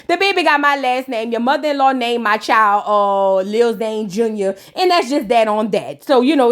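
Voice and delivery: normal talking voice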